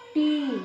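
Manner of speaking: टी with a long held ee. Shown as a demonstration. Teacher showing the big ee matra on ta, long vowel. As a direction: draw the vowel of टी right out. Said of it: "बड़ा आवाज़ निकलेगा".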